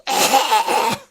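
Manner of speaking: high strained choking cough. Acted. Choking on poison gas.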